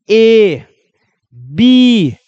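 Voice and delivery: pronouncing letters for students; demo letters. Shown as a demonstration. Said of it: "अब जैसे मैं बोला हूं ए".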